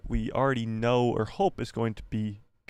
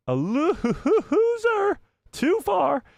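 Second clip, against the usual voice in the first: high, wailing mock sob on drawn-out words; hammed-up blubbering, pitch shooting way up; Michael Scott crying; 4 dB louder, much slower than usual. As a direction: wail it like an exaggerated crybaby. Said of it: "He starts crying. He starts going".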